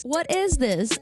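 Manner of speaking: usual speaking voice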